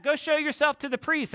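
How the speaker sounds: high-pitched call across a distance; yelling back. Acted. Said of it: "he's yelling back".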